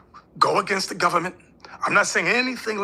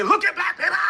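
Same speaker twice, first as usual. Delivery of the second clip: mocking shout, much higher; 12 semitones higher, 4 dB louder than usual. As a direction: shout it like an outraged news anchor. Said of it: "Don't come to me and say".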